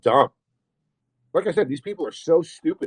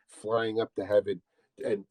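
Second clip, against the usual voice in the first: ordinary talk, nothing marked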